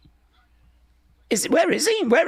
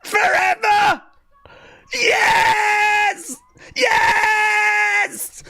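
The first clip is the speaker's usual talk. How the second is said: excited shout; 8 semitones higher, much slower than usual. Real, not acted. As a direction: yell with pure joy. Cheering the moment.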